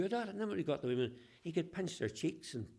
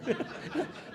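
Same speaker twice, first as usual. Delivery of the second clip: laughing; 4 dB louder than usual. Real, not acted. Laughing at his own joke.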